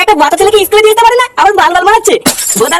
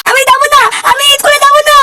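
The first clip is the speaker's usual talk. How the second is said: put-on wailing child; 7 semitones higher than usual. Child wails refusing school.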